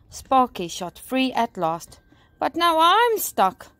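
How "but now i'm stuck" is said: high, loud, slow exclamation; dismayed gasp. A narrator's reading. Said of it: "gasped firefighter Fred".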